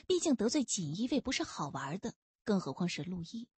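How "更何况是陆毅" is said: plain reading voice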